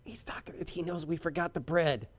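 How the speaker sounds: hushed retold whisper between disciples; softly imitated worried whisper. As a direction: lean in and whisper it. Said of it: "you could just see 'em whispering to each other".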